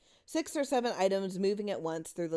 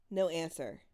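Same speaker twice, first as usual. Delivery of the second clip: plain reading voice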